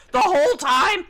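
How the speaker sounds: high, very loud, hammed-up outcry; Missus Doubtfire movie quote. Player quoting a favorite movie line, hammed-up outcry. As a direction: shriek it as an incredulous movie quote. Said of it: "only line from that movie".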